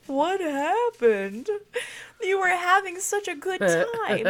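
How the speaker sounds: high, loud, drawn-out mock concern; imitating baffled husband. Husband finds wife crying, imitated baffled concern. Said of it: "he's like".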